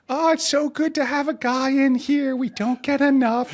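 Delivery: put-on high, sweet older lady voice, a little slow; sweet older woman. Older woman in yoga class welcoming him, sweet high voice.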